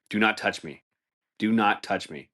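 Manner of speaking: usual speaking voice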